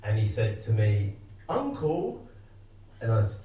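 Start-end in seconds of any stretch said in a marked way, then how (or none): 1.5-2.2 put-on high, soft kid calling uncle; a hint of a small nephew, pitched up; lightly voiced child. Voicing nephew calling his uncle.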